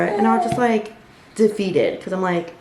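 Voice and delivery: ordinary talk, nothing marked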